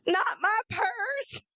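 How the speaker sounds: high, slow, crying wail over the purse; hammed-up despair, voice breaking high; mock wail. Realizing her purse is lost, mock sobbing wail. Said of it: "And I'm like".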